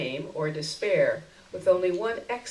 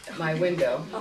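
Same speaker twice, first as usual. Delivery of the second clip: ordinary delivery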